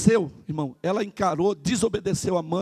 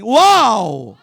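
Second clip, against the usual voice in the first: louder short wow; demo shout; 7 dB louder than usual. Shown as a demonstration. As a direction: shout it out for the congregation. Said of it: "faça assim". (pastor puxa o uau com a igreja, mais alto)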